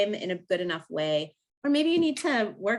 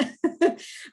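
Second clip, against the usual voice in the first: a short, high, airy giggle; quick sheepish laugh; breathless laugh; 10 semitones higher than usual. Real, not acted. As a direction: laugh it off, embarrassed at her own intensity. Laughs at her own passion.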